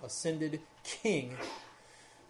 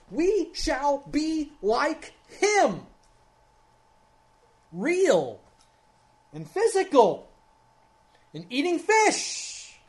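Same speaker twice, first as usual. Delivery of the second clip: slow, much higher and louder preaching, building to a peak; preaching at full force; 11 semitones higher, 10 dB louder, much slower than usual. Acted. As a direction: swell to a triumphant climax on each phrase. Sermon climax, voice building.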